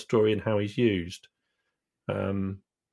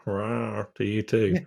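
slow, playful bear growl; much slower than usual. Acted. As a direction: drawl it like a lazy bear. Replying in bear character.

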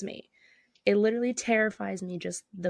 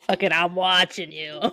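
Roz voice; 2 semitones lower, 4 dB louder than usual. Retold or imitated. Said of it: "Roz from Monsters, Inc".

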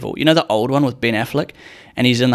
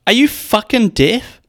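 much higher, louder and slower, in a voice unlike his own; imitating the girlfriend's indignant retort; hammed-up outraged question; mock exasperated snap; 10 semitones higher, 4 dB louder, slower than usual.

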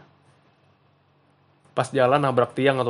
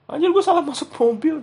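startled realization; 5 dB louder than usual. Retold or imitated. Friend realizes wrong car.

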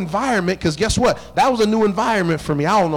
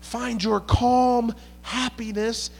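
slow, drawn-out sermon delivery; preaching; 4 semitones higher, 3 dB quieter, much slower than usual. Acted. Pastor reading Paul's call to rejoice, slow. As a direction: stretch the words out for the church.

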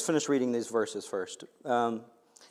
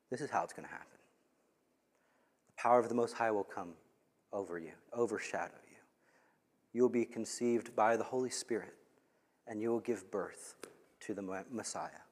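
tender angel; 5 semitones lower, 12 dB quieter, faster than usual. Retold or imitated. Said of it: "He says".

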